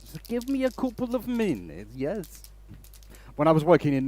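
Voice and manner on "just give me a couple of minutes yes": high, slow mock catchphrase; mock tech guy. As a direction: squeak it like the impatient tech guy. Mimicking tech guy's catchphrase.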